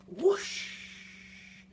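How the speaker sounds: plain reading voice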